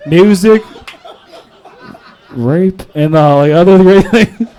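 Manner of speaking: ordinary talk, nothing marked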